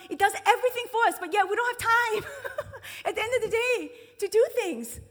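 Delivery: high, loud, fast laughing complaint; amused exasperation. Real, not acted. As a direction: laugh at our own irony. Laughing at busy modern life.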